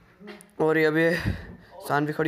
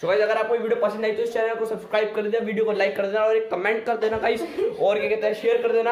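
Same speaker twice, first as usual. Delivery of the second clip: upbeat outro; 4 semitones higher than usual. Acted. Channel outro to viewers.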